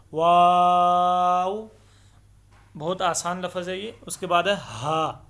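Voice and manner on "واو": واو held long and loud in a chanting tone; long recited letter. Shown as a demonstration. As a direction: stretch واو out slowly, full voice. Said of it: "واو کو بھی تین سے پانچ الف کے برابر لمبا کرنا ہے". (واو کو لمبا کھینچ کر تلاوت کے انداز میں پڑھیں)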